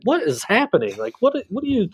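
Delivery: retelling a baffled reaction to fans wanting pictures; incredulous disbelief. Retold or imitated. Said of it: "And I'm like".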